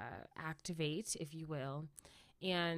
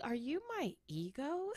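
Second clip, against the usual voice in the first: surprised question; 8 semitones higher than usual. Retold or imitated. Startled by voice in head.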